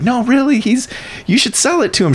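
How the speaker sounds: high-pitched, eager insistence; insistent student. Retold or imitated. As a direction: imitate a student earnestly pressing the point. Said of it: "She's like".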